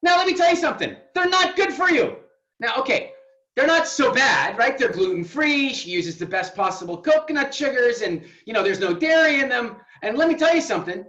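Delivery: loud, high, teasing rant; mock rant. Coach teasing Ariel in a loud mock rant. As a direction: ham up the outrage. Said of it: "I'm totally exaggerating".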